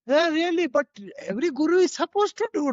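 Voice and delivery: high-pitched, incredulous retort from a disciple; surprised objection. Retold or imitated. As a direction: imitate a puzzled follower pushing back, voice lifted high.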